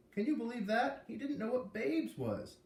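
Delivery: high, incredulous, slowing at the end; can't believe he didn't know; amazed disbelief. A narrator's reading. Kid marvels at friend.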